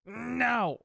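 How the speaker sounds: imitating a defiant toddler's high-pitched no; angry voice. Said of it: "he puts on this angry voice".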